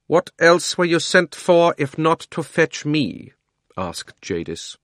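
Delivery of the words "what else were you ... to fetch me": higher, louder, slow and commanding; imperious queen. Acted. Queen Jadis demanding the boy fetch her, imperious. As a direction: speak down to him like a queen.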